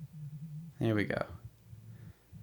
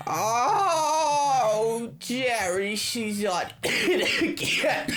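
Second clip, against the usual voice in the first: high, long-drawn mock wail; melodramatic wail; 10 semitones higher, much slower than usual. Lonely mother calling to her TV idol, hammy wailing. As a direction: wail it out, stretching the oh.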